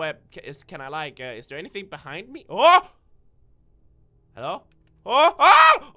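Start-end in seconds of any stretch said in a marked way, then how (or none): 2.5-2.9 short, loud startled "oh"; startled yelp. Real, not acted. Player jumps at the game, startled. 5.1-5.9 two loud, rising yelps of fright; startled yelps. Real, not acted. Player spots the bear, startled yelps.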